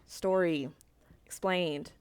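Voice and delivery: usual speaking voice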